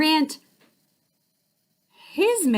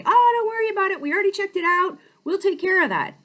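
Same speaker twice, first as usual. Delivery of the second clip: eager salesman voice; 9 semitones higher, much faster than usual. Retold or imitated. Car salesman waving off her excuse, higher and breezy. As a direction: brush off the worry like a keen dealer. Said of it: "I said".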